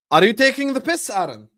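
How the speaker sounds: who does he think he is; exasperated outburst. Real, not acted. Streamer outraged at a friend over the score, exasperated.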